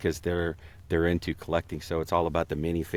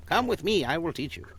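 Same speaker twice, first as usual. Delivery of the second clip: master teacher; 9 semitones higher than usual. Acted. Guest showing how Splinter sounds, high master teacher. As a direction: beckon like a mystical mentor. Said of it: "is what he sounds like".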